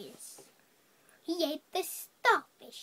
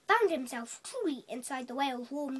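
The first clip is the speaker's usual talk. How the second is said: plain reading voice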